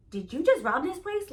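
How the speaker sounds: acting out startled disbelief at a friend; alarmed disbelief. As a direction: blurt an incredulous accusation. Alarmed reaction to friend's hurry.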